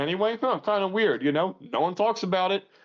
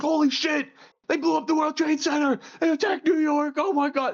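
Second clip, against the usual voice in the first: retold alarmed shout; 11 semitones higher than usual. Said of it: "I was like".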